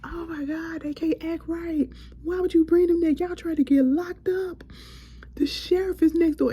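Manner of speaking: high exasperated plea. Retold or imitated. Scolding Natalie for bringing the cast, high exasperated plea. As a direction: plead with her, half laughing, half crying. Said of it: "I said".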